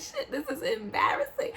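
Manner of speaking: high, laughing through the words; embarrassed laugh. Retold or imitated. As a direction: laugh it off, mortified. Stuck at the door laughing.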